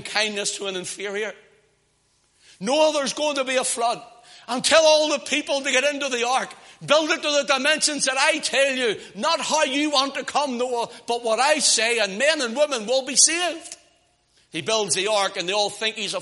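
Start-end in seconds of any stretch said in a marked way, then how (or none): 2.6-13.8 higher-pitched, insistent command; God commanding Noah. Acted. God telling Noah to build the ark, commanding preacher's voice. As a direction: deliver it as a divine order from the pulpit.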